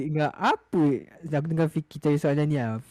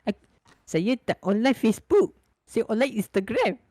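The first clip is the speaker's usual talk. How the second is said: put-on high girlish voice; 8 semitones higher than usual. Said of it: "dia kata".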